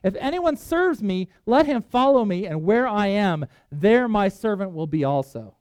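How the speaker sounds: slow, stressed preaching of each phrase; preached verse; preaching emphasis. Acted. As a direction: lean into every key word. Preacher quoting Jesus forcefully.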